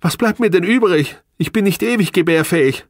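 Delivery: high, agitated self-defence; upset protest. A narrator's reading. Wife defending herself about the marriage contract, high and upset. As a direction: argue back in a high, rising voice.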